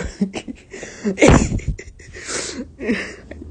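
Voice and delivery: high, wheezy laugh; helpless giggles breaking up the reading; real laughing fit. Real, not acted. Reader cracks up at her own story, helpless high laughing.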